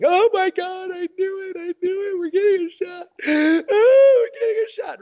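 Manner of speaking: hammed-up kids' wail, very high. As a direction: wail it like a child in despair. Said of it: "they're wailing".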